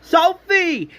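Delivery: very high, loud cry of the name; high-pitched yell, falling at the end; desperate cry. Retold or imitated. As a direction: cry out to someone in danger. Crying out for Sophie.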